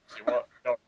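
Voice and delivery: usual speaking voice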